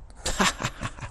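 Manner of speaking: staccato, put-on ha-ha laugh; mock defiant laugh. Batter laughing at the pitcher, put-on laugh.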